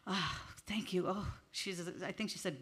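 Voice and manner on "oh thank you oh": much softer, hushed thanks; mildly voiced softly touched woman; soft touched reply. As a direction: murmur it, moved and quiet. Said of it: "She's like".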